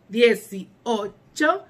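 ordinary talk, nothing marked

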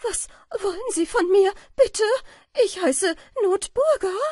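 high, loud panicked plea; horrified cry. A narrator's reading. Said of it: "schrie der Falter". (entsetzter, hoher Schrei)

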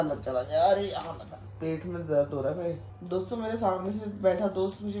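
usual speaking voice

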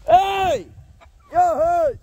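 high, loud call across the yard. Real, not acted. Farmer calling over to Hutch, high loud hail.

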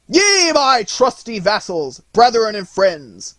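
high, loud, drawn-out call to arms; rallying cry. A narrator's reading. Nutcracker rallying his vassals with drawn sword, loud heroic cry. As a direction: shout to rouse troops for battle. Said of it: "waved it in the air, and cried".

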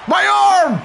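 joking pain yell. Real, not acted. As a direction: cry out as if your arm got hit. Pained yell at game hit.

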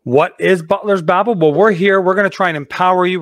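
ordinary talk

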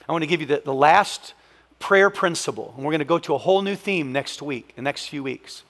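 ordinary talk, nothing marked

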